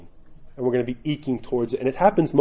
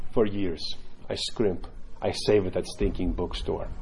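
low, measured imitation of a solemn monk; serious monk; 6 semitones lower, 6 dB quieter, slower than usual. Monk answering the zealous convert, low mock-serious. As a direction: drop low and softer, deadpan confession. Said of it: "very, like, serious".

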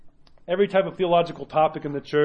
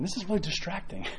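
inner thought; 9 dB quieter than usual. Retold or imitated. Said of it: "he's thinking".

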